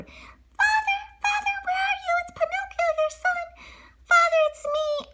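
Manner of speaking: high, far-reaching call for father. Acted. Pinocchio searching the ocean floor for his father, high calling.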